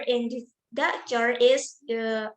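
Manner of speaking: ordinary talk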